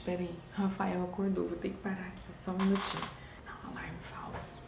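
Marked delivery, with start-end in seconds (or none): 3.5-4.6 a real hushed whisper. Real, not acted. Mother whispering during a late stream so her baby won't wake.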